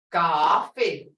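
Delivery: "coffee" with a drawn-out "ah" first; long "ah". Shown as a demonstration. Teacher telling students to listen, long vowel. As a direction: stretch the first syllable of "coffee". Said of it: "I hear a ah".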